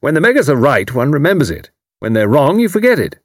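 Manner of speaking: loud, high hearty military man; retired commander teasing his neighbour, loud and hearty; bluff old commander. A narrator's reading. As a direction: boom it out like a blustering retired officer. Haydock voiced.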